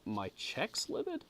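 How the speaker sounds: "cheeks" said as "checks", rising in doubt; puzzled misreading. Real, not acted. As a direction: read "cheeks" with a short e, like "checks", slowly questioning. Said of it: "It definitely says checks".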